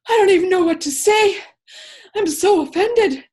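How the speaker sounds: high, indignant mock outrage; offended. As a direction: play the huffy, insulted teammate. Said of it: "they're like".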